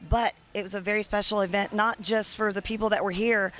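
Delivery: ordinary delivery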